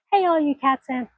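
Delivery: a light imitation of a big cat show host; Carole Baskin. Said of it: "channel my inner Carol Baskin".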